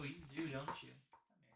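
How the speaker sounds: normal talking voice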